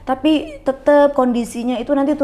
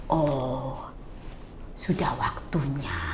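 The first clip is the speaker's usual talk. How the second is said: mocking hushed whisper, slow and much softer; whispered resignation; 8 semitones lower, 12 dB quieter, much slower than usual. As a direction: breathe it like a gloomy verdict. People say her time has come.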